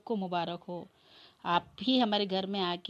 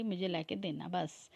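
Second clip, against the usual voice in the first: usual speaking voice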